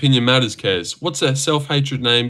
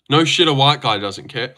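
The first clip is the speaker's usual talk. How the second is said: ordinary talk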